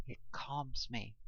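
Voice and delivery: soft, slow admission; quietly confessing. A narrator's reading. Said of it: "I admitted".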